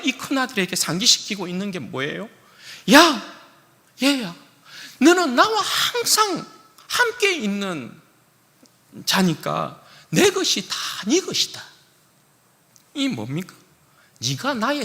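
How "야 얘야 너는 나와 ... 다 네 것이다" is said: high, choked plea with a catch of crying; father tearfully reminding his son. Acted. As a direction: let the voice break on 너는 나와 항상. Father pleading with elder son.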